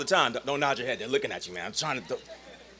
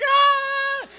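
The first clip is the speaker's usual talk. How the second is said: very high, loud "aah" scream; hammed-up shriek of someone being chased; freshman's mock scream; 6 dB louder than usual. Little freshman chased by seniors, high mock scream. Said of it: "little freshman who's like".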